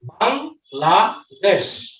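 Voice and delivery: Bangladesh split slowly into বাং, লা, দেশ with clear gaps; syllable by syllable. Shown as a demonstration. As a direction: stretch each syllable of Bangladesh and pause after it. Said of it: "যতটুকু ভাঙা যায়". (বাংলাদেশ থেমে থেমে, প্রতিটি অক্ষর আলাদা করে)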